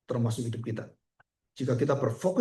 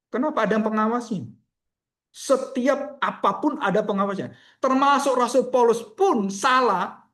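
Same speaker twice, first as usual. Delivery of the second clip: higher-pitched, emphatic sermon delivery; impassioned preaching; 8 semitones higher, 5 dB louder than usual. Real, not acted. Preacher warning the congregation that nobody is above correction, impassioned. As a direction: preach it forcefully, driving each point home.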